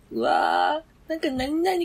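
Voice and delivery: put-on high squealy girlish gushing with a laugh; imitating a giggly girl fawning over a guy; mock giddy girl. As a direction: gush like a giggly girl at a mixer. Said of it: "みたいなって".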